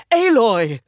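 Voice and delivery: loud high shout of her name; calling out. Acted. Matriarch summoning Aloy across the gathering, loud high call.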